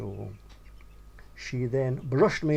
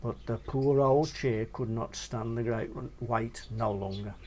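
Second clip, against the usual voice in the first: usual speaking voice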